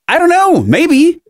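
high, squeaky joking maybe; mock high answer. Real, not acted. Answering friend's joke about being killed, squeaky playful maybe.

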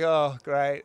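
laughing through a dry "great"; laughing sarcastic. Retold or imitated. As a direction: chuckle it out wryly. Wry reply to Kelly's yell.

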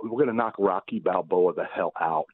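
normal talking voice

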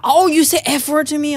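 high, affronted mock outrage; retold anger. Imitating the man offended by swearing, mad. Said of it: "he got really mad".

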